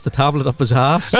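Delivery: ordinary talk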